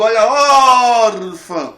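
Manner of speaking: very loud commentator cry, ÓR of "órfã" held long; Galvão Bueno. Acted. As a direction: bellow it like a sports call, stretching the stressed syllable. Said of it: "lembrar da narração do Galvão Bueno". (narração esportiva, "órfã" com o ÓR esticado)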